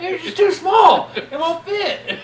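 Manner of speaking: very high, drawn-out shouted whine; hammed-up high-pitched protest; mock complaint. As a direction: wail it slowly and loudly through laughter. Acting out complaint about piss bag.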